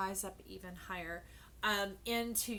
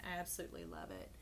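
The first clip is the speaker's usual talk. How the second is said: ordinary talk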